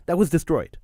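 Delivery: ordinary talk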